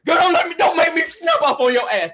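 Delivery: mock Kandi snapping at someone; imitating Kandi. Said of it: "That's Kandi".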